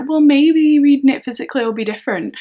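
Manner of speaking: acting out her own hopeful hunch; mock hopeful thought. Telling viewers her reread plan, mock hopeful. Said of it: "I'm like".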